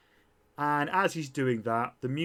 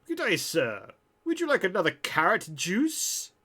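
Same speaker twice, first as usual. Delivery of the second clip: higher-pitched, swooping waiter voice; robot bartender; 7 semitones higher, 3 dB louder than usual. Acted. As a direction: chirp a polite offer like a robot server. Voicing robot bartender to customer.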